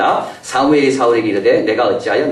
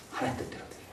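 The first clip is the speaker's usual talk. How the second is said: whisper; 20 dB quieter, faster than usual. Acted. Whispered answer.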